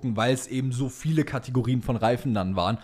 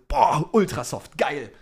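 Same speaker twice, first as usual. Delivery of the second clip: excited awe; 3 semitones higher, slower than usual. Retold or imitated. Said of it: "und denkst dir so".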